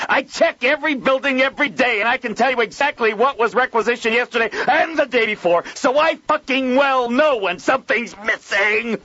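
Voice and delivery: high, loud furious tirade; apoplectic rant. Acted. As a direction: explode with rage. Joel apoplectic ranting.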